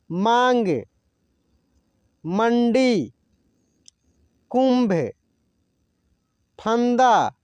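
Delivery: each word said alone, careful and drawn out; slow teacher-style list reading. Shown as a demonstration. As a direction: model every word for students to repeat.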